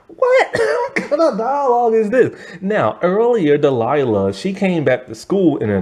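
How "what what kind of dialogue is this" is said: very high what, then laughing through it; laughing disbelief. Retold or imitated. The viewer mocking the movie's dialogue, laughing in disbelief. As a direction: crack up at the bad writing. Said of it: "I'm just like".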